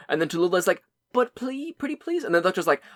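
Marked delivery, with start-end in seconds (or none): 1.1-2.2 high, soft begging; pleading high voice. Retold or imitated. Tallulah pleading to the doctor.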